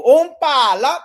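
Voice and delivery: loud, high festive shout in a hammed-up Greek aunt voice; hammed-up Greek aunt whooping at a family party; mock Greek aunt cheer. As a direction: throw out a boisterous party cry like an overbearing Greek aunt. Playing overbearing Greek aunt.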